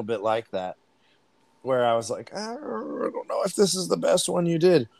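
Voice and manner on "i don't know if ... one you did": doubtful quote. Retold or imitated. Podcaster retelling their reaction to the Cockney attempt, doubtful. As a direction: voice a reluctant, sceptical verdict.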